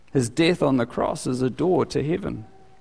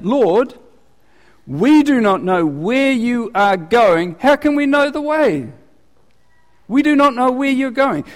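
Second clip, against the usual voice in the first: higher, louder, puzzled plea, the line slowed; voicing Thomas; 10 semitones higher, 5 dB louder than usual. Preacher voices Thomas asking Jesus the way, higher, louder and puzzled. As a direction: speak as Thomas, confused and asking. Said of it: "old Thomas, he's a practical-minded guy".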